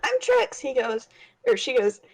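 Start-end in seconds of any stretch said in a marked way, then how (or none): 0.0-0.6 much higher character chirp; high put-on character voice; Trix's voice. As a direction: chirp her name as Trix. Player replays her character's line.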